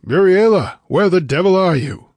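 high, loud shout demanding an answer; sudden shout. A narrator's reading. Man searching the chamber for the vanished girl, shouting. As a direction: shout it out, searching for someone missing. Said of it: "he shouted suddenly".

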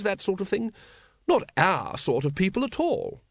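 high-pitched, leisurely grand-lady voice; airy. A narrator's reading. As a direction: list it off with airy disdain. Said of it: "she said airily".

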